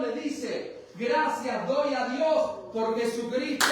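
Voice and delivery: loud, high, fervent preaching climax; preaching at full force. Acted. Pastor preaching to the congregation, rising to a fervent shout. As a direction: preach it out, building to a cry.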